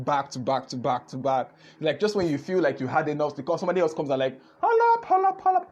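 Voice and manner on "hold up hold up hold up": high squealing hold up, much higher and louder; mock hold up. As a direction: jump in like a hyped host cutting someone off. Acting out someone interrupting.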